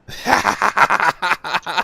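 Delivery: high, loud burst of laughter; a hearty cackle; real laughter. Real, not acted. Laughing at timeout.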